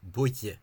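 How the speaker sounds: "buille" said slowly as a model word; historical pronunciation demo. Shown as a demonstration. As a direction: sound out "buille" clearly in two parts. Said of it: "So this y sound".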